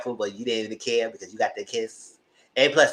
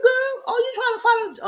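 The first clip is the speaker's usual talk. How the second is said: put-on high, loud "Girl" in disbelief; high incredulous; 5 dB louder than usual. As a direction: shriek it in mock disbelief. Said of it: "I said".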